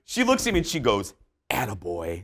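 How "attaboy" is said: a quiet, low murmured attaboy; a hushed aside to the neighbour, attaboy; soft, low attaboy. Retold or imitated. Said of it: "like that".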